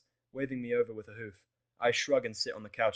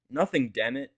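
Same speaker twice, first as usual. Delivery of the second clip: curt, louder irritated reply; snapping; 3 semitones higher, 8 dB louder, slower than usual. A narrator's reading. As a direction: bite back irritably. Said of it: "I snap".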